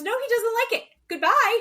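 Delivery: hammed-up furious send-off; retold outburst. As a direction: throw the gift back, yelling goodbye. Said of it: "And she goes".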